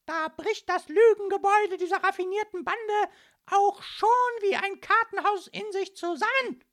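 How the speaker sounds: piercing, triumphant accusation; very high policewoman at full volume; loud and shrill. A narrator's reading. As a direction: shout it as if the case is cracked. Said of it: "rief die junge Polizistin laut und schrill".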